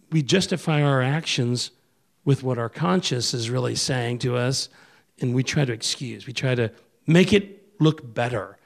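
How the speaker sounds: usual speaking voice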